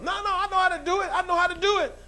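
high, fast, whiny insisting; impatient kid. Retold or imitated. Child refusing a parent's help, high and impatient. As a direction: brush off the help like an eager child.

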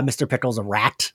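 ordinary talk, nothing marked

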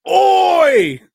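a sudden "Oi!" yelled high and loud. Retold or imitated. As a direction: bark out "Oi!" like a caricature guard. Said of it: "He just points and goes".

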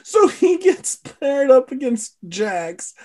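high, slow, still cracking up; real amusement straining the voice; recovering from laughter. Real, not acted. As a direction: get the story out through leftover giggles.

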